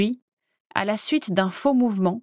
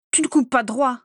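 reproche lancé plus haut; a yelped complaint, pitched up; glapissement; 4 semitones higher than usual. A narrator's reading. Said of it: "glapit Bellatrix". (glapissement de reproche, voix plus aiguë)

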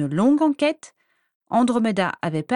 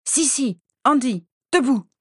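shouting wake-up; 6 semitones higher, 4 dB louder than usual. Acted. Sister waking her sisters loudly.

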